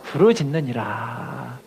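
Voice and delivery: drawn-out, slow reading for the congregation to echo; slow call-and-repeat. Shown as a demonstration. A preacher leading the congregation in a slow, drawn-out reading. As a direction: stretch each word so they can follow. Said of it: "따라합시다".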